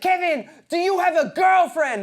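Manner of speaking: high, loud mock Belgian accent; Christoph. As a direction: shout it in a bad Belgian accent. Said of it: "that's not a Belgian accent".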